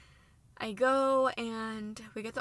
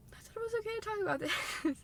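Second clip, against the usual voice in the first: high, cracking voice that catches into a tearful laugh; fighting back real tears; voice breaking; 12 semitones higher, faster than usual. Real, not acted. Telling viewers, her voice breaking with tears. Breaks down retelling the surgery news.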